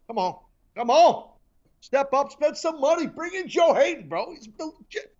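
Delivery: high, loud pleading hype; excited plea. Real, not acted. Fired-up host pleading with Bills fans, high and loud. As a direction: beg the fans to sign him.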